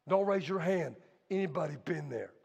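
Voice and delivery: usual speaking voice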